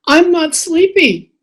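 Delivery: put-on high-pitched little kid's protest; protesting child. Child telling parent at bedtime, high protesting voice. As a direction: insist like a child fighting sleep.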